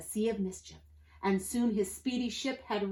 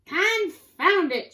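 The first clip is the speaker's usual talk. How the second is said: high, loud, drawn-out pirate oath; exasperated shout; 10 semitones higher, 10 dB louder, much slower than usual. A narrator's reading. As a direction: shout it as if stomping. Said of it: "shouted Pete".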